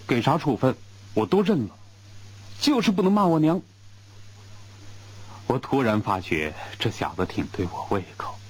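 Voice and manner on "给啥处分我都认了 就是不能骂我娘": high, fast stubborn protest; voiced higher and faster, indignant; flushed insistence. A narrator's reading. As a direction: defend his mother, rushing. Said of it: "吴根柱红头胀脸地说".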